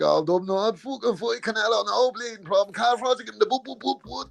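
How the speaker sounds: pumped-up thick Dublin rant, pitched high; McGregor gone all Dublin. Retold or imitated. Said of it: "all pumped up on something and he goes back".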